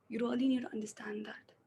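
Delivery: hopeless whisper. Shown as a demonstration. Acting student in the depressed take, whispering. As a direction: breathe it out, almost voiceless. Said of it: "let me go with the first one".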